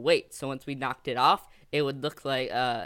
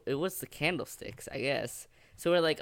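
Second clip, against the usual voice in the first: normal talking voice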